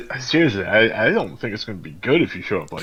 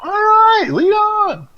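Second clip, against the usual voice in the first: very high, louder put-on voice; high squeal; 3 dB louder, much slower than usual. Telling the companion to lead the way, high-pitched. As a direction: squeak it out in falsetto. Playful in-character reply to companion.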